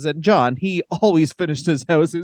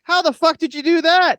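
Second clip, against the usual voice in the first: much higher, louder, stunned question; amazed disbelief; 11 semitones higher, 3 dB louder than usual. Retold or imitated. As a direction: blurt it out in total disbelief. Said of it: "And I was like".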